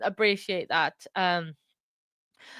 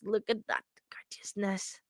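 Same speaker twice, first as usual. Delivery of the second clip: adoring whisper; 9 dB quieter than usual. Real, not acted. Cooing over niece's photo.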